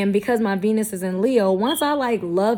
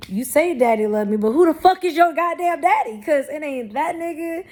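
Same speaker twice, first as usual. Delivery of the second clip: mock indignant retort rising higher on the question; sassy comeback; 6 semitones higher than usual. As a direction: snap back at the baby with attitude. Mock retort to unborn son.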